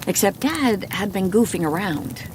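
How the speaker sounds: ordinary delivery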